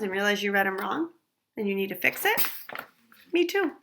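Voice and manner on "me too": normal talking voice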